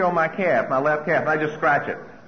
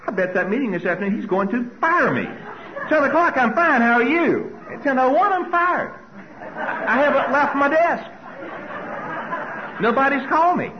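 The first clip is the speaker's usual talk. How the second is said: high, rushed and rising with nervous laughs; mounting mock panic; panicky spiral; 9 semitones higher than usual. Panic building in his thoughts.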